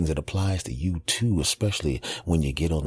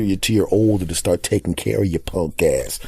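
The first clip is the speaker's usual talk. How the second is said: ordinary talk, nothing marked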